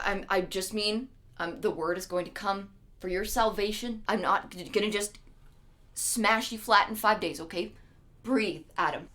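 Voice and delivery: low, soft, halting explanation; gentle reassurance. Retold or imitated. God soothing a frightened Adam, gently and quietly. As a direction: talk someone down quietly, feeling for words.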